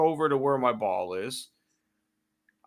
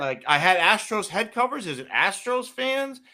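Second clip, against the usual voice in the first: sarcastic questions; 7 semitones higher than usual. Real, not acted. Mocking the you people remark.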